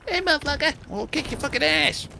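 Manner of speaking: put-on high squeaky kid trash-talking; little kid voice. Mimicking kids trash-talking on voice chat, squeaky kid voice. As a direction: play a bratty little kid yelling insults. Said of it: "hear these little kids going".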